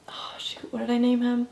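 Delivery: under-breath whisper to herself; whispered aside. Real, not acted. Whispering to herself after forgetting a name. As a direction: whisper it while trying to remember a name.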